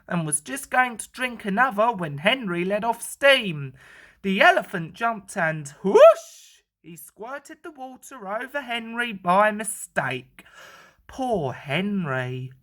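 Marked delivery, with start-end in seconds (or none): none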